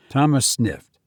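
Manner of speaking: usual speaking voice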